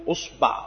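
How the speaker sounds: أصبع with damma on the hamza and fatha on the ba, uṣbaʿ; vowel demo. Shown as a demonstration. Teacher listing the variant for students, careful uṣbaʿ. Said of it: "ضم الهمزة".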